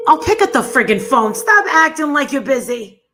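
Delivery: loud, exasperated shouting; frustrated yelling. Real, not acted. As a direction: yell it at the phone. Yelling at mother's voicemail.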